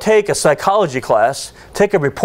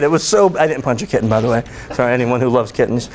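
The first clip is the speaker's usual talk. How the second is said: ordinary talk, nothing marked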